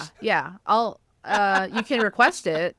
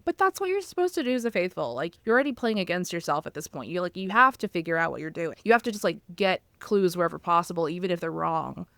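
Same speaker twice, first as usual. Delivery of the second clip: ordinary talk, nothing marked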